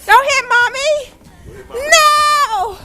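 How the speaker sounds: high, stretched-out squeal of protest; high playful plea. Real, not acted. Mom begging child not to hit with snow, high loud plea. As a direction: beg loudly, dragging the words out. Mom pleading as snowball threatens.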